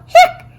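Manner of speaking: one high loud hiccup; squeaky hiccup. Acted. Girl hiccuping while friends think, high squeak.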